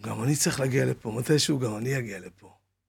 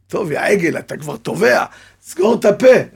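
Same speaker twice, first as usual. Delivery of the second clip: loud, high scolding of himself; mock yell at himself; 5 semitones higher, 9 dB louder than usual. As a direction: bark at yourself to shut up. Mocks himself while drowning. (צעקה לגלגנית על עצמו)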